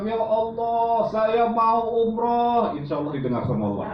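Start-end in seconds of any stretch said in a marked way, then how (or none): 0.0-2.7 much higher, very slow imploring cry; pleading prayer. Shown as a demonstration. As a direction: beg God with a drawn-out high cry. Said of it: "sambil bilang". (memohon kepada Allah dengan suara tinggi dan lambat)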